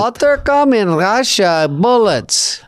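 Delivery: slow, high hammed Russian accent. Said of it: "he goes".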